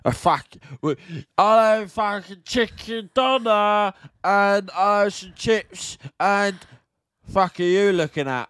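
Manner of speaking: put-on slow, slurred drunk ordering; drunk kebab shop punter. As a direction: drag the order out like a hammered bloke. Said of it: "there's always one".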